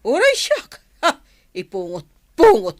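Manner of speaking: high, loud retort that stays forceful; heated threat; furious outburst. Acted. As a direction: snap back at the top of your voice. Quarrel in the cell, threatening.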